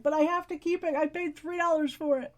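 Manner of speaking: high-pitched mock protest. Host voicing their younger self defending a silly braid, mock protesting.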